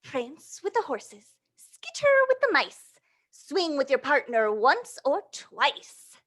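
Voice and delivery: put-on lively read-aloud to little kids; reading to children. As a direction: read the rhyme with bounce for the children. Reading picture book aloud.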